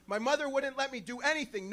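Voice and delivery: ordinary talk, nothing marked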